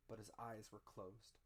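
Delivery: ordinary delivery, nothing marked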